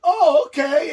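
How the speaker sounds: high, loud, eager simpleton; gullible compliance. Retold or imitated. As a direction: agree instantly with naive delight. Voices gullible opponent happily complying.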